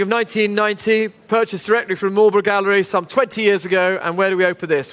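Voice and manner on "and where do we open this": ordinary talk, nothing marked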